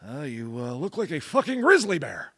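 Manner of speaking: high-pitched blunt remark, imitated; imitating promoter. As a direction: toss it off like the odd old promoter. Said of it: "he's just like".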